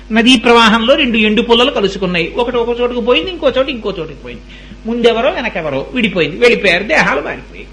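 ordinary talk, nothing marked

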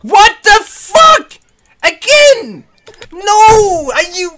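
high, loud disbelieving shriek; outraged shriek. Real, not acted. Shrieking at the game.

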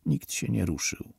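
ordinary delivery, nothing marked

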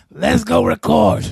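put-on low, loud, slow drunken holler; blasted drunk. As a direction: imitate a friend drunk out of his mind. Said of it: "he's blasted out of his mind".

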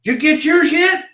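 high, loud carnival call; barker hook. Retold or imitated. Barker luring passers-by to his tent, loud hook. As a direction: bait them with a loud question. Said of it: "I'd say".

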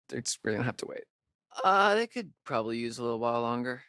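ordinary talk, nothing marked

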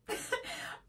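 breathless silent giggle; a giggle caught behind the lips; stifled giggle. Real, not acted. Laughing at her own dinosaur pajama joke, stifled breathy giggle.

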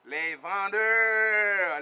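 very slow, stretched les vendeurs, held long and hammered; drawn-out accusation. Acted. Denouncing Haitian intellectuals as sellers, drawn out and heavy. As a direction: drag the word out to brand them sellers.

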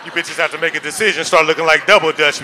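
loud comic harangue; loud rant. Acted. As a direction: bark it at the crowd.

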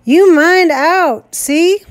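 high, loud, slow warning yell; loud drawn-out yell; shouting. Acted. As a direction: shout the threat down from above. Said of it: "Roger was shouting".

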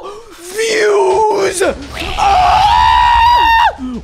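very high, mock hype scream; hype yell. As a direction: scream it like clickbait. Joking clickbait hype.

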